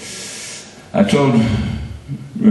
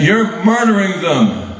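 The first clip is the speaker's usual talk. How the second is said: defiant retort; 7 semitones higher, slower than usual. Retold or imitated. Standing up to confront commissioner.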